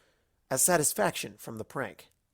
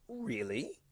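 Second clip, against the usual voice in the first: surprised; 9 dB quieter than usual. Acted.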